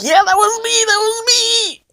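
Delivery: high-pitched excited mock squeal; eager confession. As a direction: blurt it out high, stretching the end. Acting eager answer to police.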